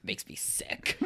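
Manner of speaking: whispered in mock disgust. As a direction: hiss it like it sickens you. Mock disgust at studio logo.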